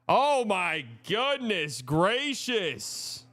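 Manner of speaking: slow, high, drawn-out amazement; a long, marveling oh my goodness; awed drawl. Real, not acted. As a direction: stretch it out in disbelief. Marveling at Celtics ball movement.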